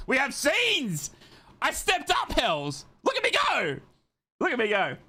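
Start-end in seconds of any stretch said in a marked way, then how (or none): 0.1-2.8 high-pitched, stretched-out bragging cry; excited crowing. Real, not acted. Crowing about the new scenes. 3.0-3.8 very high, squealed boast; excited crowing. Real, not acted. Crowing, then repeated more calmly.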